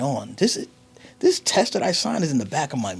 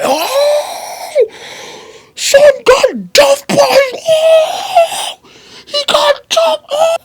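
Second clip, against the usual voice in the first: very high, loud, laughing taunt; mocking laughter; 17 dB louder, much slower than usual. PTA kids laughing at the boy who got hit, very high and loud. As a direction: crow and cackle like kids watching a beatdown.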